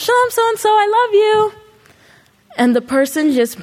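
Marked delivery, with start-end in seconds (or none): 0.0-1.5 much higher, gently loving greeting to a lonely woman; sweet loving greeting. Retold or imitated. Preacher retelling a church member's kind greeting, high and tender. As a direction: retell her kind hello in a lifted, tender voice. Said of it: "she said".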